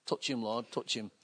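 low, hushed mock prayer; distant timid prayer. Mimicking cautious churchgoers praying over someone, hushed and low. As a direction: imitate praying softly from well back. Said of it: "they'll pray from a distance like that".

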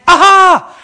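hammed-up eureka; aha. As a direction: shout the discovery with delight. Acted aha moment.

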